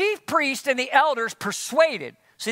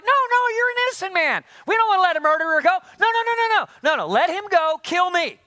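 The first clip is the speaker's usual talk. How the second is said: high, fast, pleading crowd voice; mock protesting crowd; 6 semitones higher than usual. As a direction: play the crowd begging him not to go. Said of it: "And they're like".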